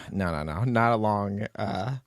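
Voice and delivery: usual speaking voice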